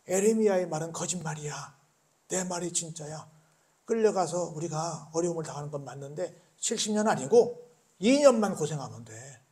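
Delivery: lightly voiced confident false prophet; false prophet Hananiah reassuring the people; a touch of false prophet. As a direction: persuade the crowd with easy comfort.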